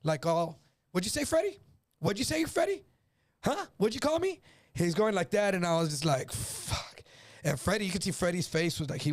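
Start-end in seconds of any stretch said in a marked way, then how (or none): 0.9-4.4 menacing challenge. Retold or imitated. Mark confronting Freddie over name. 6.3-7.0 breathed out under the breath, no voice; a horrified whisper to himself; whispered curse. Retold or imitated. Narrator horrified when his name slips, whispering.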